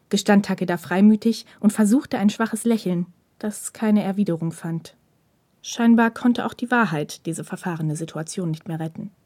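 ordinary delivery, nothing marked

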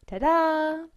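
high, loud, drawn-out fanfare; triumphant reveal. A narrator's reading. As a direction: present it proudly with a big flourish. Stolz präsentiertes Pausenbrot. (stolz und triumphierend präsentiert)

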